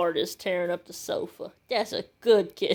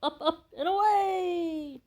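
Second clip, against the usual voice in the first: heroic catchphrase; 9 semitones higher than usual. Acted. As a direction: announce it like a caped hero taking off.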